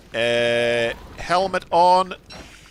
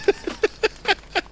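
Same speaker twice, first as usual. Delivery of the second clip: breathy laugh. Real, not acted. Accidentally started forest fire.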